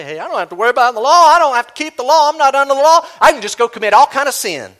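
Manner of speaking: fast and loud, a careless believer shrugging off the law; mock careless believer. Preacher mocks a believer who treats grace as license, fast and loud. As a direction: brush off the law like it's nothing. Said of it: "Don't just say".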